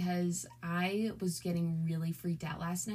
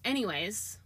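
normal talking voice

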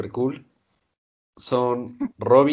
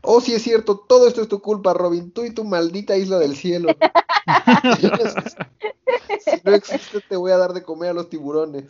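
mock rage at Robin; furious Nami; 8 semitones higher than usual. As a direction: blame her and threaten, hammed up. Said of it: "Nami se súper enoja".

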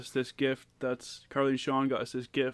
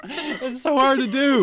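laughing; 11 semitones higher, 4 dB louder, slower than usual. Real, not acted. Giggling through nervous intro.

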